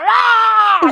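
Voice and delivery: very high, drawn-out mock roar; imitated crowd roar. Inmates wanting to kill the Sex Pistols, high imitated roar. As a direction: roar like a whole room of inmates. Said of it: "Everybody's going".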